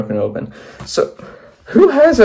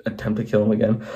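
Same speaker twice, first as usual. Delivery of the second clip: ordinary delivery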